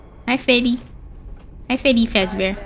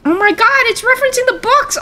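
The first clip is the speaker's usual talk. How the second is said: high, thrilled exclamation; excited discovery; 12 semitones higher, faster than usual. Real, not acted. Gamer spots a reference to the books, high and excited. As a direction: burst out with high-pitched amazement.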